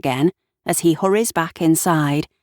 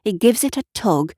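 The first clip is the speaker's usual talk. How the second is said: usual speaking voice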